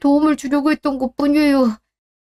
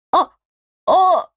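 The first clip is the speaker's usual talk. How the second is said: sharp shout; 6 semitones higher, much slower than usual. Acted. Wife cuts off her husband's excuses with a sharp cry. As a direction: snap to cut him off.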